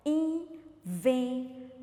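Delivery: INVENTANDO slowly split as in, ven, tan, do; syllable by syllable. Shown as a demonstration. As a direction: count out "Inventando" in four separate beats. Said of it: "duas sílabas, três ou quatro?". ("Inventando" devagar, sílaba por sílaba: in, ven, tan, do)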